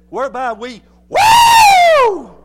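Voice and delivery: words breaking into a high, loud whoop; exuberant scream. Shown as a demonstration. Preacher showing what "cry" means, exuberant scream. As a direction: burst out "woo" in place of the word. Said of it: "That's the best way I can describe that word".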